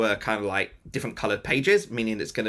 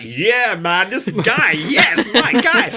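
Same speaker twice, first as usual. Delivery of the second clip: loud, high, laughing hype; thrilled stunt coordinator; 8 semitones higher, 8 dB louder, much slower than usual. Retold or imitated. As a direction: cheer him on like a buddy.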